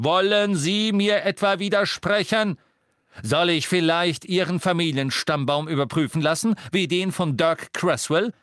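loud, high intimidation; indignant threat. Acted. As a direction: pull rank on someone contradicting you. Harry menacingly pulls rank.

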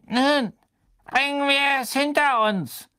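put-on very high, thin old man; elderly committee member, thin and high. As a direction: pipe it like a frail old official. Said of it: "sagte die dünne Stimme des alten Ausschussmitglieds".

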